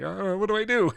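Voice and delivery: high, bewildered mock panic; a high, helpless what-do-I-do. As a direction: act lost and panicky. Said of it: "almost like panic".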